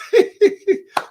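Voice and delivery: sudden high loud laugh; real laugh bursting out; burst of laughter. Real, not acted. Laughs at a comment.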